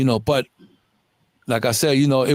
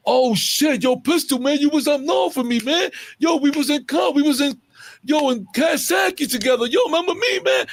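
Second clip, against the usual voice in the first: much higher, gushing, eager reunion voice; excited big guy; 12 semitones higher than usual. Retold or imitated. Big crew guy greets Pistol, high-pitched excited gushing. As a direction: gush like an old friend from upstate. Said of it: "the biggest guy they had when it was like".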